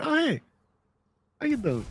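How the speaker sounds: mock-friendly greeting; oblivious player's greeting. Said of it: "walked out on the seaside and went".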